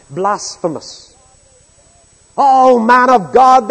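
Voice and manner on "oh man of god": much higher, louder, slow mock reverence; mocking sarcasm. Preacher voicing soldiers jeering at the prophet, loud mock reverence. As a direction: drag it out in loud scornful mockery. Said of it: "is the implication".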